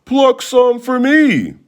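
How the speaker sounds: high, slow pleading; begging. A narrator's reading. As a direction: plead with them, drawn out. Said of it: "the wolf begged".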